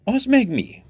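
high-pitched baffled question; wondering. A narrator's reading. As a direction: ask in astonishment, pitch leaping up. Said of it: "csodálkozott a helytartó".

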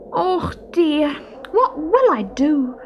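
high, fretful lament of a little stoat; worried sighing. A narrator's reading. Little stoat with no winter home frets to himself, sighing worriedly. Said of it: "sighed Barney".

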